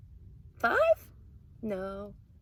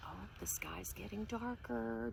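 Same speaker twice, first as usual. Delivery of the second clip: whisper; 12 dB quieter than usual. Acted. Showing kids sleeping animals, soft whisper. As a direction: whisper so the animals stay asleep. Night coming, hushed.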